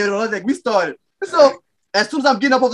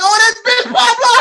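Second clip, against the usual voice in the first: put-on drunken yell; 11 semitones higher, 5 dB louder than usual. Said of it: "they're like".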